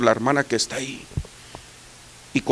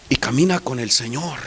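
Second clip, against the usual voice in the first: usual speaking voice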